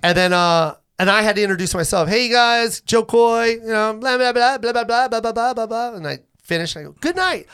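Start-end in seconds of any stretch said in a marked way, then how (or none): 2.1-5.9 high, sing-out self-introduction trailing into blahs; mock stage patter. Comic introducing himself to the crowd, mock stage patter. 7.0-7.5 high, called-out farewell; stage sign-off. Retold or imitated. Comic finishing his set to the crowd, called-out sign-off.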